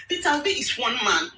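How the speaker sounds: ordinary talk, nothing marked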